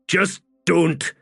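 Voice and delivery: high, strained plea; anguished plea. Acted. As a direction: beg him to stop. Fiddler begs, tears in his eyes.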